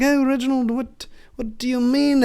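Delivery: a hint of a prim Briton; posh British reply. Second British scout asking Reginald, British accent. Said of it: "an effeminate British man".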